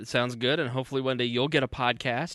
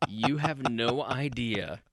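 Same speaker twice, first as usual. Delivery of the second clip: ordinary talk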